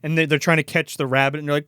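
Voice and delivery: ordinary talk, nothing marked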